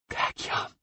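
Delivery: faint, dying rasping whisper; croaking whisper. Acted. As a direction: croak it out almost voiceless. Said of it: "a croaking whisper".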